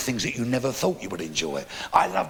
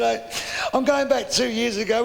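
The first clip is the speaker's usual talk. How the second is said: high, tearful comic voice; crying old man; 9 semitones higher than usual. Retold or imitated. Old man confiding in a stranger, mock weeping. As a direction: begin the sad tale through mock sobs. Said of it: "crying his eyes out".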